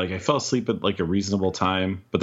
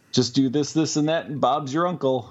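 a hint of an Aussie accent, pitched higher; faint mock Australian, raised pitch; half-hearted Australian; 7 semitones higher than usual. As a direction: lightly voice the Australian vaper without really trying the accent. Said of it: "I'm not even gonna try to do an Australian accent".

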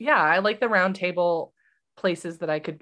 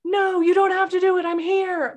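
much higher and louder protest; emphatic protest; 12 semitones higher, 6 dB louder than usual. Retold or imitated. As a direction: cry out against it, all in. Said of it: "And I'm like".

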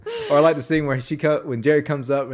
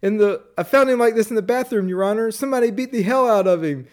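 high-pitched mock report; imitated bailiff; 7 semitones higher than usual. Bailiff telling Your Honor, imitated report. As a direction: report it high to the court, hammed up. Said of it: "the bailiff says".

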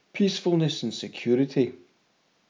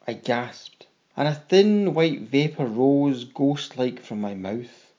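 usual speaking voice

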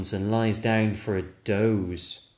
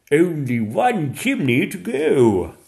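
louder, booming grateful Santa; jolly Santa; 6 semitones higher, 9 dB louder than usual. Acted. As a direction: thank him with a big hearty voice. Santa voiced.

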